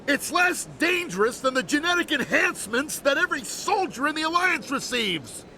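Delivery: high-pitched, exasperated rebuttal; exasperated arguing. Acted. Brother-in-law argues back about treatment.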